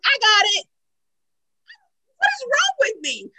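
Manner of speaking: high, worked-up self-reproach; exasperated self-mockery. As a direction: lament your own stubbornness in a high voice. Retelling her stubborn refusal of help.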